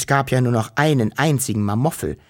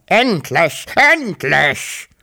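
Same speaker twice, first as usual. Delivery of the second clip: loud croaking cry of relief; a freed monster crowing over and over; 6 semitones higher, 5 dB louder, much slower than usual. A narrator's reading. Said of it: "krächzte er noch lauter und scheußlicher".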